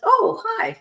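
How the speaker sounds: high-pitched, surprised hello; retold surprised greeting; pleasantly surprised greeting. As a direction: greet an unexpected visitor in a high lilt. Greets a friend popping in.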